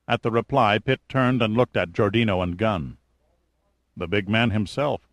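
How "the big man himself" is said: plain reading voice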